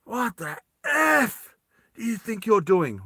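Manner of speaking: high, drawn-out F of outrage; Vas swearing. Acted. Vas confronts Roy over the supplies, outraged challenge. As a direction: stretch the curse, then demand an answer. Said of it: "he just swears".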